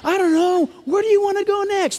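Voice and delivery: a lightly voiced, passionate diner; lightly imitating an animated Middle Eastern diner; excited chatter. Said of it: "They're talking like this".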